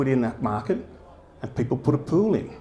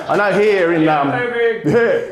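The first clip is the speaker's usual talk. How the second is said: laughing; 6 semitones higher, 6 dB louder, much slower than usual. Real, not acted. Laughing mid-line.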